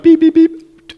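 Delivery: imitated alarm beeps. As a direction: mimic an alarm clock. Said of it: "it's your alarm clock going off".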